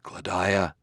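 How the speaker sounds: usual speaking voice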